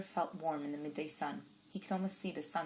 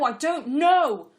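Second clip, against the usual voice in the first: slow, very loud, higher drawn-out cry; desperate shout; 10 semitones higher, 16 dB louder, much slower than usual. Acted. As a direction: shout it out, at the end of his rope. Pestered by the woman's questions.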